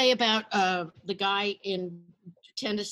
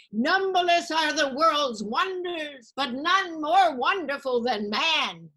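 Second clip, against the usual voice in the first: high, slow, loud declaiming of a Greek chorus; grand tragic recital; 7 semitones higher, 6 dB louder, slower than usual. Retold or imitated. Student reciting Greek drama to the class, slow and grand. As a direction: intone it like a stage tragedy.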